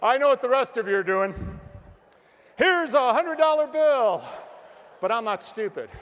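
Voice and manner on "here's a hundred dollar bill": louder, slow, tempting; teasing loud offer. Acted. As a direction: dangle the offer loudly.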